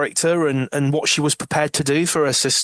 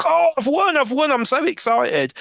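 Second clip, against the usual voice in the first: much higher, squealing with delight; over-the-top excited squeal; thrilled raffle winner; 11 semitones higher than usual. Retold or imitated. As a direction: play the giddy winner of the raffle. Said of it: "and she's like".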